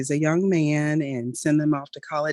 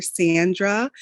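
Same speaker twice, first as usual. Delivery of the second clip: normal talking voice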